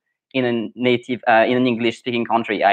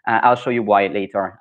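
ordinary talk, nothing marked

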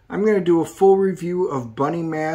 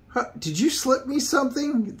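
much higher, softer, startled voice; spooked thug voice; 11 semitones higher, 5 dB quieter than usual. Acted. Henchman suddenly finds himself in the caves, much higher and startled. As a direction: pitch it way up, dazed and rattled. Character reacts to sudden cave vision.

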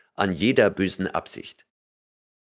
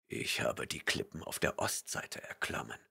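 much softer, low whispered murmur; 9 semitones lower, 16 dB quieter than usual. A narrator's reading. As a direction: mutter it half to yourself. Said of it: "murmelte er".

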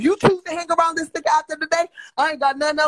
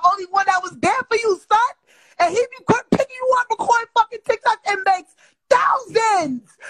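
heated, near tears; 7 semitones higher, 4 dB louder than usual. Real, not acted. Angry rant about friend.

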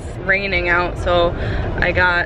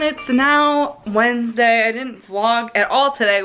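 normal talking voice

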